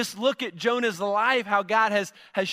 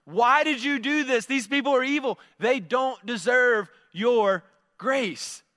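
higher, louder mock outrage at God; indignant Jonah; 5 semitones higher, 3 dB louder than usual. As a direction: voice Jonah's indignant protest, slowing at the end. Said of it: "And he's like".

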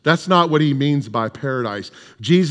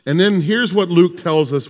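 ordinary talk, nothing marked

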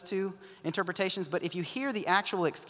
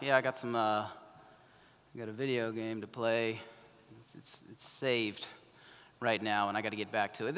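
lower, softer and slow, dragging the words; slow mumbling excuse; 7 semitones lower, 7 dB quieter than usual. Retold or imitated. College student stalling about a video game, slow mumble. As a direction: drag out a half-hearted excuse. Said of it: "they're like".

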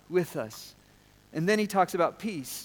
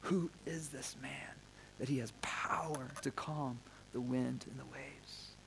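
whispered in hushed awe; awed whisper; 3 semitones lower, 12 dB quieter than usual. Retold or imitated. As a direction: breathe it like frightened disciples. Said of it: "they said".

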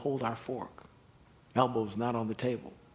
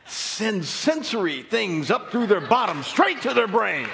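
preaching at full force; 11 semitones higher, 10 dB louder than usual. Acted. Preaches to the congregation.

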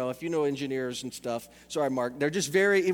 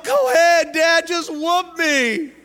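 high, loud, hammed-up martyr begging for punishment; mock-tragic son surrendering to his dad; playing martyr; 12 semitones higher, 11 dB louder, slower than usual. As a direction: throw yourself on the sword, loud and slow at the end. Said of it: "I'm gonna play martyrdom".

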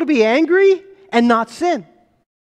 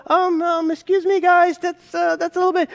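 mock-timid, higher and hesitant, um-ing; timid polite complaint; 5 semitones higher than usual. Pastor mocking a Jesus too shy to confront the money changers, hesitant and higher. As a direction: play it meek, as if scared to interrupt. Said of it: "doesn't come in and go".

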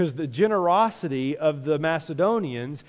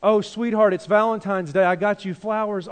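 high, loud sweetheart gush; mock romantic spouse, louder and higher; sweet gushing spouse; 6 semitones higher, 6 dB louder than usual. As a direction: gush over your sweetheart. Acted spouse giving flowers.

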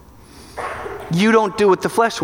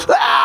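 mock anguished cry; 6 semitones higher, 4 dB louder than usual. Part of the mess cries out.

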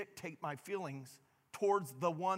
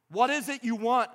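a sharp, irritated snap, higher and louder; snapped question; 5 semitones higher, 5 dB louder than usual. Acted. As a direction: bark it at the interruption. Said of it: "I snapped".

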